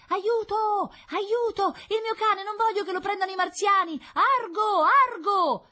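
very high, loud cries for help; desperate shouting. A narrator's reading. Paolo chasing his dog to fool the firemen, frantic shouting. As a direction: yell frantically after a runaway dog. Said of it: "si lanciò a rincorrerlo gridando".

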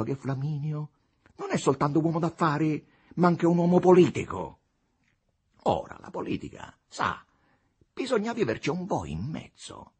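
high-pitched, patronising explanation; smooth deputy, condescending. A narrator's reading. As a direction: explain politics as to a novice. Capolino lectures on politics. (deputato condiscendente che spiega la politica)

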